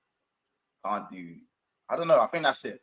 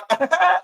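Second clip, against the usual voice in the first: high, loud mimicked laugh; imitated signature laugh; 11 semitones higher, 8 dB louder than usual. Showing the other YouTuber his very specific laugh, imitated laugh. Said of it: "'Cause his laugh's like".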